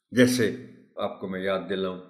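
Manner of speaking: normal talking voice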